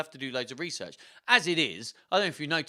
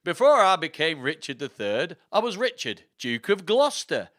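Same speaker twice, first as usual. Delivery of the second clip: put-on higher, louder theatrical accent; Richard the third; 4 semitones higher, 8 dB louder than usual. Richard announcing himself as Duke of Gloucester, proud put-on accent. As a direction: proclaim the title with relish in a put-on accent. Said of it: "fun to say in this accent".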